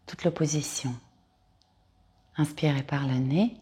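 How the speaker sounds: calm Yoga Nidra guide with long pauses; guided meditation. Acted. Yoga teacher guiding listeners to lie down and release, guided meditation. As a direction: lead them into rest, phrase by unhurried phrase.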